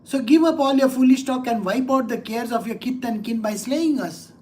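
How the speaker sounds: ordinary delivery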